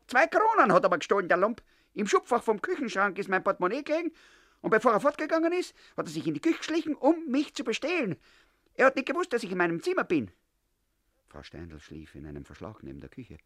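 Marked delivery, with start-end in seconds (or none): 0.1-10.3 put-on high, loud, indignant old woman; landlady to grandmother, accusing the boy: high loud outrage; shrill accusation. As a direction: rail at the thief like a wronged landlady. Frau Steindl accuses the boy.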